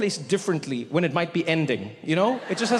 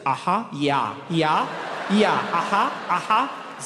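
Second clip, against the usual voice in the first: unamused German audience; much slower than usual. Pretend. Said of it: "Germans just sit in the audience like".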